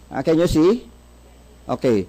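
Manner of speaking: usual speaking voice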